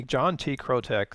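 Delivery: usual speaking voice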